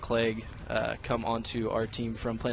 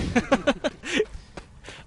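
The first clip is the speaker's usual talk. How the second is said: high laugh. Real, not acted. Laughing at an empty room.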